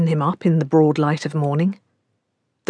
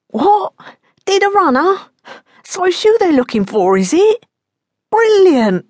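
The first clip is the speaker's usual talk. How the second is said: higher, strained bluster; a frightened small-time crook, voice pitched up; nervous young man; 13 semitones higher, 4 dB louder than usual. Acted. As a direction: cover panic with forced cheer.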